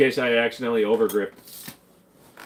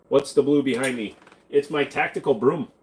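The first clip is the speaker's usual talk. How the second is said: usual speaking voice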